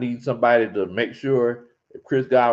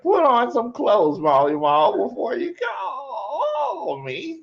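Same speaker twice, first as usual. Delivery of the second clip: high, slow mock yell breaking into laughter; mock scolding boss; 8 semitones higher, much slower than usual. The boss scolding the caller, in a laughing mock yell. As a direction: scold him through a laugh.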